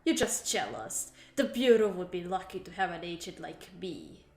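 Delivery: lightly voiced Russian spy accent; haughty agent with a hint of a Russian accent; a touch of a Russian accent, proud and offended. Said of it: "Did I do the accent well?".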